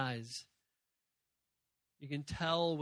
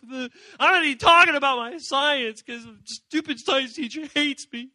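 higher, much louder hammed-up whine to God; whining complaint; 8 semitones higher, 13 dB louder than usual. Teen whining loudly to God about a math test. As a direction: overdramatic kid pouring out troubles.

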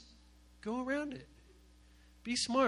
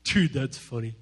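lower, laid-back dude voice; lazy bro voice; 8 semitones lower than usual. Retold or imitated. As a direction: laugh it off like a bro. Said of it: "they're like".

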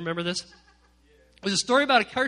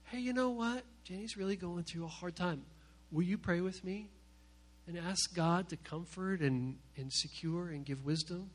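soft, confiding prayer request; discreet, gentle request; 4 semitones lower, 11 dB quieter than usual. Acted. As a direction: ask softly, keeping the secret.

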